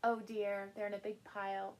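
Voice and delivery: plain reading voice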